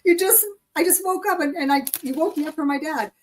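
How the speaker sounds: very high, laughing and half crying; retelling a complaint to her partner with a laughing cry; laughing disbelief. Retold or imitated.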